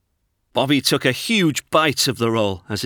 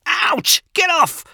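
high squeaky pained yelp; a tiny mouse squealing ouch; 5 dB louder, slower than usual. Acted. Noel the mouse yelps as Bobby grabs his nose, high squeak.

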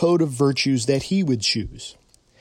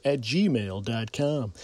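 slow and clear; 4 semitones lower, 6 dB quieter, slower than usual. Real, not acted. Repeating email address slowly.

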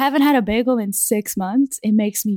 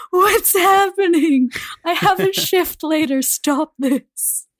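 crying; 10 semitones higher, 6 dB louder, slower than usual. Retold or imitated. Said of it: "I was like".

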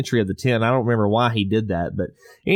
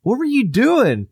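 high, slow puzzled question; boy asking his dad what happened; startled question; 11 semitones higher, slower than usual. Retold or imitated. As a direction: ask it drawn out and bewildered. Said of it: "It's like".

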